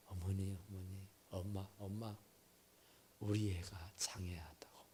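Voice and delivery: low, soft pleading, fading to a whisper on 장애; a daughter confiding in tears, barely audible; new mother whispering her fear to her mother; hushed tearful plea. Retold or imitated.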